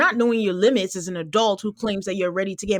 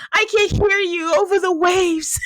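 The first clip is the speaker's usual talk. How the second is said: high, hammed-up crying call; tearful shout, played for laughs; mock tearful; 8 semitones higher than usual. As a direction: whine through put-on sobs. Mimicking a crying woman's reply.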